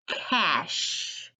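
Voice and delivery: ordinary delivery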